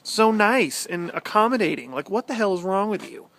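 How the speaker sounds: teasing complaint, hammed-up; mock exasperation. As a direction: rant in play-exasperation. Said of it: "And I'm like".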